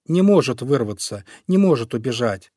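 ordinary delivery

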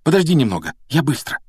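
high, urgent protest; insistent plea. Acted. Boys squabbling over the mirror.

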